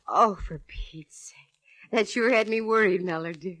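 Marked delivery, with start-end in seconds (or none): 0.1-1.9 slow, breathy whispered sigh of relief; a slow hushed groan of relief; exasperated whisper. Acted. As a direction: let it out under your breath, slowly.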